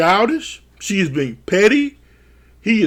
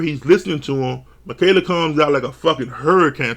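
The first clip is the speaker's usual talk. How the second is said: normal talking voice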